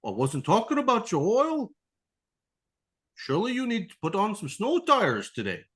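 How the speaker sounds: high, pushy salesman voice; imitating an eager dealer; mock salesman upsell.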